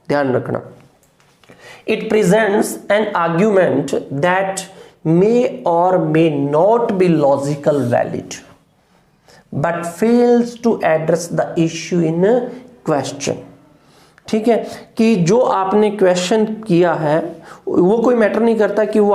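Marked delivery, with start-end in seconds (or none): 1.9-13.3 very slow, word-by-word definition reading; slow dictation read. Shown as a demonstration. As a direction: dictate the definition slowly for notes. Reads definition slowly. (परिभाषा बहुत धीरे बोलकर लिखवाना)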